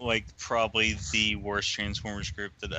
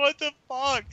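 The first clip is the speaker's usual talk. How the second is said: mock disbelief; 13 semitones higher than usual. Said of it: "I was like".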